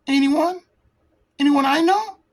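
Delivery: lightly voiced hurt young woman; light girl voice, jealous question. Kitty asks, hurt and jealous.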